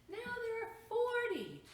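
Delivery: high, loud storybook exclamation; excited. A narrator's reading. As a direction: cry it out in alarm at the crowd. Crowd of monsters growing.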